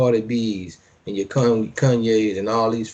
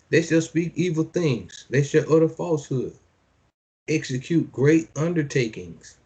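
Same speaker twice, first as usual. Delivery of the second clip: measured preacher's reading; scripture reading; 5 semitones higher than usual. Acted. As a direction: declaim each line like a decree. Reads scripture aloud.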